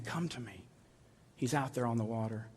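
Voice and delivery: soft, tender invitation; soft call. Acted. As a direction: draw them in softly. Jesus voiced, final invitation.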